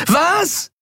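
very high yelled question; screaming. A narrator's reading. Endriel unable to hear Kai, screaming. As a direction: yell it as if you couldn't hear. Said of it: "schrie sie".

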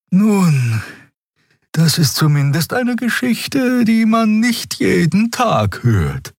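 very high put-on character voice; Xea, noncommittal. A narrator's reading. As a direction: pitch it high and draw out the ending.

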